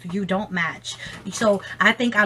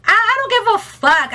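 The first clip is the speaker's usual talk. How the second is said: defiant outburst; 10 semitones higher, 5 dB louder than usual. Real, not acted. Heated declaration about returns.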